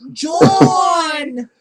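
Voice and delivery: put-on loud, high Philly-accent yell of the name; Philly accent shout. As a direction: call John like a Philly grandma. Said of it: "It's like a Philly accent".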